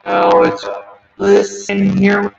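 glitchy robotic audio distortion. Real, not acted. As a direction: voice warped like a broken robot. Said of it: "[name] has robot voice again".

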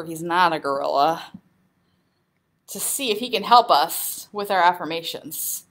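ordinary talk

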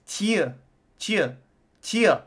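each "tieu" high and falling, with a Y glide; Y-vowel pronunciation. Shown as a demonstration. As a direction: isolate "tieu" with a fronted French vowel. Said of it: "like, a Y vowel".

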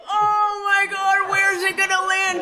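high loud panicked yell; panicked shout. Retold or imitated. Band watching the flying bug, high panicked shout. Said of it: "we're all like".

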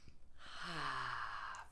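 soft, low sigh out; relaxed sigh. Shown as a demonstration. Yoga leader loosening up the class, soft low sigh. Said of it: "Take in some good deep breaths".

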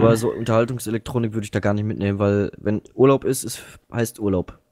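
ordinary delivery

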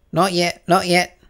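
high, loud hurried cut-in; the Rabbit cutting off the king; hasty interruption. A narrator's reading. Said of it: "the rabbit hastily interrupted".

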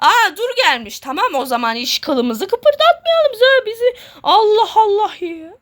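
high-pitched panicky exclaiming; startled, pitch climbing high; alarmed. Real, not acted. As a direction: react in alarm as it suddenly appears. Monster arrives, player panics.

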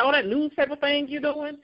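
high, offhand mocking of her mom; dismissive mom. Mom shrugging off her daughter's magazine, high and dismissive. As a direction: brush it off like a doubtful mother.

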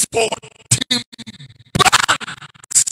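loud emphatic. Real, not acted. Preacher stressing bank collapses.

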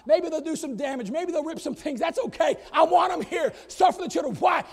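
preaching that builds to a loud cry; rising preaching. Acted.

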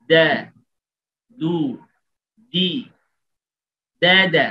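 দা, দু, দি with a bouncing release; dal with echo. Shown as a demonstration. Teacher drilling দাল with কলকলা. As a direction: let দ bounce at the end. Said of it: "এই কলকলাটা সহ আমরা দাল উচ্চারণ করি".

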